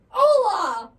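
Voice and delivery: startling possessed greeting; sudden shout. Shown as a demonstration. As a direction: jump out and yell it with a laugh. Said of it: "all of a sudden".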